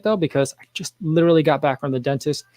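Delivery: ordinary talk, nothing marked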